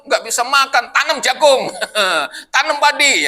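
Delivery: hammed-up angry shout at the hungry people; mock angry rant. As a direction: play a boss yelling at his people, half joking. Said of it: "Nah, marah-marah".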